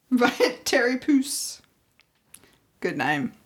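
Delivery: ordinary delivery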